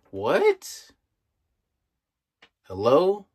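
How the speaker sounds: high, loud disbelieving what; incredulous what. Real, not acted. Commentator reacting in disbelief to the cop's threat. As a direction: pitch it up in disbelief.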